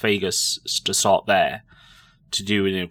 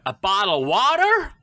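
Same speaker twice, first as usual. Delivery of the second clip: higher, louder fake American "bottle of water"; Americanised bottle of water; 9 semitones higher, 4 dB louder than usual. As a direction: try an American accent to be understood. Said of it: "I just went".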